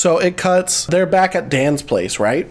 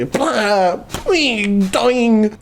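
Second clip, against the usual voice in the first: cartoon bouncing noises, pitched up; vocal sound effects of falling downstairs; imitated tumble; 5 semitones higher, much slower than usual. Storyteller acting out Dan falling down stairs, cartoon tumbling noises.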